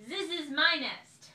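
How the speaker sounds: loud, high stress on my; loud possessive duck; loud claim. A narrator's reading. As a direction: insist loudly it's yours.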